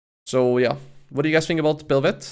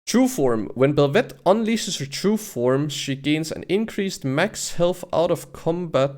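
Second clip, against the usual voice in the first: emphatic, higher read-out of game text; reading aloud; 4 semitones higher, slower than usual. Real, not acted. Streamer reads true form ability to viewers, emphatic reading. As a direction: announce the ability slowly, with punched key words.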